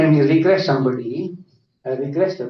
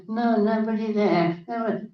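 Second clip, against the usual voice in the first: put-on higher, small child's voice; baby talk; 6 semitones higher, 3 dB quieter than usual. Regressed patient answering the hypnotist, imitated baby talk. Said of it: "Talking like a baby".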